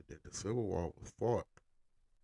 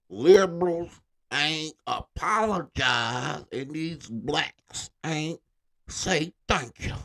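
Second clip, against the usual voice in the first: put-on higher, loud, slow cranky old man; old gramps; 7 semitones higher, 8 dB louder, much slower than usual.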